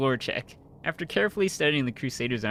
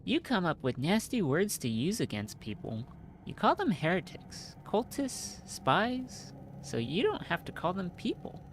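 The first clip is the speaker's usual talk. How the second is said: higher, softer, earnest rebuke; reproachful companion; 7 semitones higher, 3 dB quieter than usual. Acted. Companion rebuking the knight over labeling people, soft and higher. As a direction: plead with him to see them as people.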